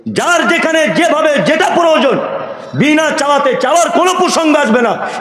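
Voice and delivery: fervent sermon cry; preaching at full force. Acted. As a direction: drive each question home to the congregation. Preaching call and response.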